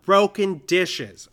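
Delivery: drawn-out playground yell; slow, loud call across the playground. Retold or imitated. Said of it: "somebody yells out".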